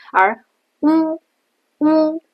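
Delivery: 乌 with lips pushed far forward; 乌 the Chinese way, lips protruding. Shown as a demonstration. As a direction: round and push the lips out on 乌. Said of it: "你的嘴唇是往前突出的".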